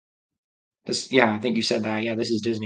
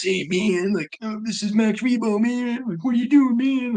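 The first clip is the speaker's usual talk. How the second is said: much higher, hammed-up jive jazz cat; 11 semitones higher, slower than usual. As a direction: squeal it like a cartoon jazz hipster. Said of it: "If it was just Max to talk like that".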